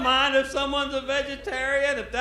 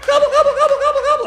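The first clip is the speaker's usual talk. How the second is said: much higher, loud turkey gobbling; turkey gobble; 10 dB louder, much faster than usual. Retold or imitated. Turkey calling to the hunter, high loud gobbling.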